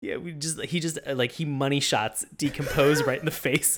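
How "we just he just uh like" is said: normal talking voice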